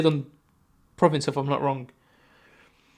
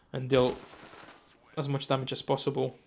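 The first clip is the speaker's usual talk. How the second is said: usual speaking voice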